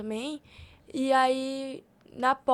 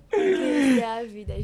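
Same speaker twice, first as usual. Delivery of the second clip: slow, loud, through laughter; laughing it out slowly and loud; 7 dB louder, slower than usual. Real, not acted. (rindo, devagar e mais alto)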